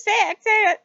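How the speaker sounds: high loud excited urging; high eager shout. Retold or imitated. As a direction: urge them on loudly. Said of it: "He goes".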